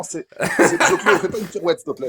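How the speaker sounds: laughing through the words, pitch jumping up; amused half-laugh while scolding. Real, not acted. Teasing Zioclo while laughing.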